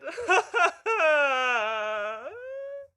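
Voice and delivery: long, loud, high mock sob; hammed-up wail. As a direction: bawl it out at full volume. Ink's acted wail after sad videos.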